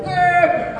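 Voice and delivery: high-pitched strained grunt; effortful squeeze grunt. Shown as a demonstration. Husband hugging wife hard to show he cares, straining grunt. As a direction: grunt as if squeezing someone tight. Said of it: "要很用力，".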